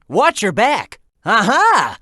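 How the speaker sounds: ordinary talk, nothing marked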